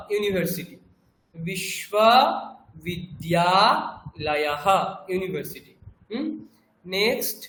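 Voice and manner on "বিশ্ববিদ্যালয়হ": বিশ্ববিদ্যালয়হ drawn out very slowly, every syllable clear, with a breathy হ at the end; teacher slowly modelling বিশ্ববিদ্যালয়হ in Sanskrit, ending with a breathy হ; Sanskrit pronunciation. Shown as a demonstration. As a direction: spell out বিশ্ববিদ্যালয়হ syllable by syllable, very slow, ending on a voiced visarga হ. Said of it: "লয়াহা বলতে হবে".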